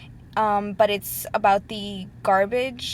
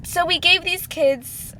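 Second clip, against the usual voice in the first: choked up; 8 semitones higher, 4 dB louder than usual. Real, not acted. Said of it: "'cause I start crying".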